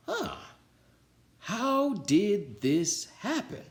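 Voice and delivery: can't believe his eyes; amazed coach. A narrator's reading. Coach Armstrong sees the team play well, high slow amazement.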